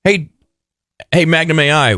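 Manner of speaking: usual speaking voice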